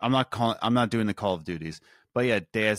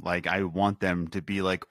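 usual speaking voice

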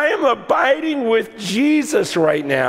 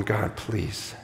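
low, soft prayer, pleading; hushed plea; 13 semitones lower, 10 dB quieter, slower than usual. Retold or imitated. Preacher retelling his prayer in the village, hushed plea. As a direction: pray it under your breath, pausing between pleas. Desperate prayer retold quietly.

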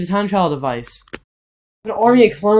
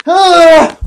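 sudden scream, much higher and louder; 12 semitones higher, 10 dB louder than usual. Acted. The hero is struck by a clone, a sudden scream. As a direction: cry out as the blow lands. Attacked during the clone fight.

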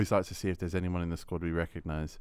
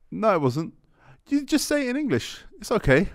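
interviewer correcting him; 13 semitones higher, 5 dB louder than usual. Retold or imitated. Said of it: "He goes".

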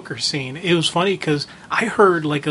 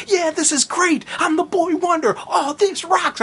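put-on high-pitched, thrilled kid sidekick; happy young Jason; 11 semitones higher than usual. Retelling Jason first putting on the costume, excited high voice. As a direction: imitate an elated boy hero, pitched way up. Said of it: "that happy sequence where Jason first puts on".

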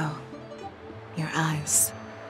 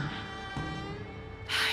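quiet, breathy sigh; regretful sigh; 10 dB quieter than usual. Acted. General regretting her miscalculation, quiet sigh.

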